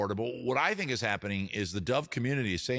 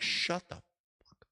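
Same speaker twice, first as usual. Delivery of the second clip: annoyed dove; 6 semitones higher, 3 dB quieter, slower than usual. Retold or imitated. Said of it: "The dove, on the other hand, is like".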